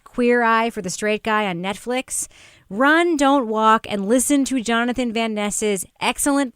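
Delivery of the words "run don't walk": slow, emphatic and a bit louder; run, don't walk, spelled out slowly; emphatic urging. Real, not acted. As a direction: press each word to urge listeners on. Urgent recommendation to listeners.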